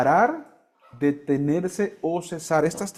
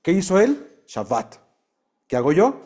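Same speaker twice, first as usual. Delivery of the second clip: ordinary delivery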